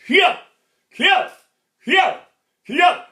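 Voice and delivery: Kiap shouted high and loud from the belly; karate kiai. Shown as a demonstration. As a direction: bark Kiap as a fighting cry. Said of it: "you say a word".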